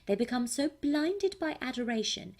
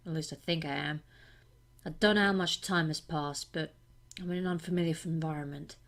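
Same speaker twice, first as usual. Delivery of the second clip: low, hushed astronaut reflecting; dramatic monologue; 5 semitones lower than usual. Acted. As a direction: wonder aloud at being brought back to life. Sci-fi monologue.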